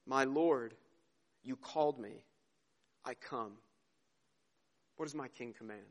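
low, soft, halting reverence, fading as it goes; softly surrender to your king, with pauses between phrases; Faramir's reverent reply. Acted. Faramir waking to see Aragorn, hushed devotion. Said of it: "And then Faramir says".

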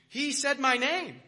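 loud, high, drawn-out amazement; hammed-up starstruck thrill. Student amazed his professor used his name, hammed-up thrill. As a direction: play it up as utterly thrilled.

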